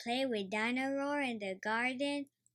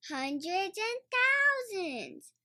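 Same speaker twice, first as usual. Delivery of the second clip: put-on a little girl exclaiming in amazement; Peppa crying out; 10 semitones higher, slower than usual. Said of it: "cries Peppa".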